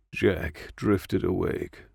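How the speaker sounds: plain reading voice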